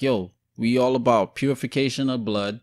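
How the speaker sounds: usual speaking voice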